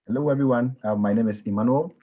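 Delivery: ordinary talk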